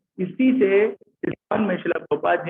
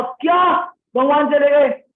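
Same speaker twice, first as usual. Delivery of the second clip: loud, high, drawn-out cry of dismay; distressed disbelief; 9 semitones higher, 5 dB louder, slower than usual. Retold or imitated. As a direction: act out Yudhishthira's pained shock, slow and loud. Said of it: "Yudhishthir को बहुत दुख हुआ". (दुख भरे अचरज में ऊँची, खिंची पुकार)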